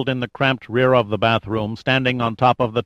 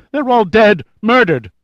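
much higher and louder, hysterical outburst; hysterical sobbing; 11 semitones higher, 7 dB louder than usual. A narrator's reading. Terrified flight attendant crying out about the dead, hysterical. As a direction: break out in a panicked wail. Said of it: "she sobbed hysterically".

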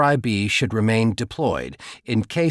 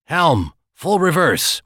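sharp, slow, higher command; snapped order; 6 semitones higher, 4 dB louder, slower than usual. A narrator's reading. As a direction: bark the order to the helm. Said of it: "Juan snapped".

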